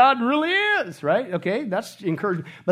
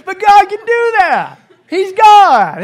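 loud, high, slow, laughing; Larry caught off guard, laughing; husband Larry's reaction; 12 semitones higher, 15 dB louder, much slower than usual. Retold or imitated. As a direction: answer in laughing, bigger amazement. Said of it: "He's just like".

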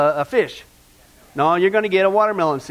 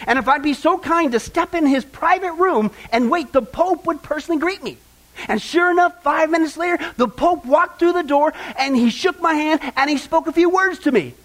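lifted, higher-pitched proud telling; gushing traveler; 6 semitones higher than usual. Acted. Woman telling hairdresser she met the Pope, gushing. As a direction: build the brag, pitch held high.

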